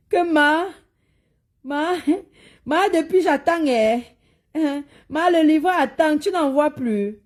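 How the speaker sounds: high-pitched pleading imitation of a scammer; mock scammer plea. Mocking an impostor's messages to a client, high imitation. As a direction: lightly mimic an impostor nagging a client. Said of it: "qui parle comme ça là".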